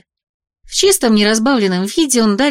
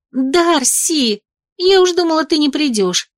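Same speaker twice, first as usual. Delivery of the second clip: excited friend; 7 semitones higher, slower than usual. Acted. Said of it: "подскочила ко мне Прия".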